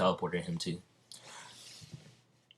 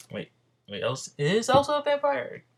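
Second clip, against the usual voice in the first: high-pitched, startled question; sudden confusion. Real, not acted. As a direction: blurt it out in surprised doubt. Storyteller suddenly doubts own plot.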